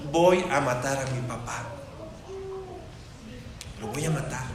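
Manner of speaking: grim plotting. Retold or imitated. Absalom plotting to kill father.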